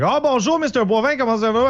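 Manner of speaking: bright high Quebecois greeting, pitch well up; Quebec French official. Retold or imitated. Government clerk greeting a caller in cheerful Quebec French. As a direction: sing out the bonjour like a chirpy French clerk. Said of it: "and they're like".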